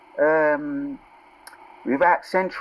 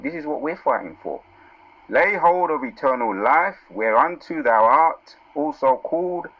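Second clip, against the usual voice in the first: raised, slow preacher's reading of the verse; scripture reading; 4 semitones higher than usual. Acted. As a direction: proclaim the verse to the congregation. Reads scripture with emphasis.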